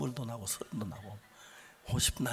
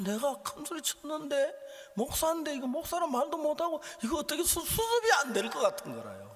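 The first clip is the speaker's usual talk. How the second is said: high, loud pulpit exasperation; worked-up, helpless outburst; agitated preaching; 6 dB louder than usual. Acted. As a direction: act out the inner panic at preaching pitch. Preaches inner turmoil loudly.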